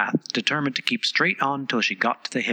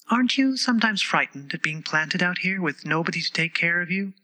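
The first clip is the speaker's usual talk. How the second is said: put-on young girl voice; 6 semitones higher than usual. A narrator's reading. Alice's voice.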